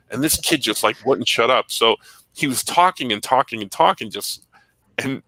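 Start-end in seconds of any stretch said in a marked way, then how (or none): none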